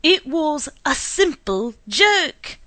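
loud, slow furious scream; loud, slow acted scream. A narrator's reading. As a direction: scream it at his wife. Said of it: "screamed Mister Taylor".

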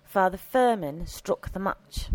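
plain reading voice

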